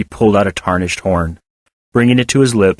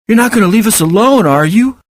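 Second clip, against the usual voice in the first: put-on worried child; 12 semitones higher, 4 dB louder than usual. A narrator's reading.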